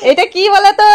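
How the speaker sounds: high, loud teasing question; teasing quiz voice. Real, not acted.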